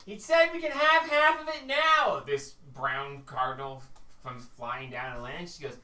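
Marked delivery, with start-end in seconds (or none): 0.0-2.2 high, loud delighted shout; excited bird call. Acted. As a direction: call out to your wife, thrilled. Bird calls to wife excitedly.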